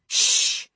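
sharp voiceless "shh"; hissed shush. A narrator's reading. Woman silencing the man calling after her, hissed shush. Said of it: "she hissed".

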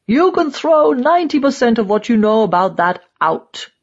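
angry. A narrator's reading. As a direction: cut in angrily and dismiss it. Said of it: "He's angry again".

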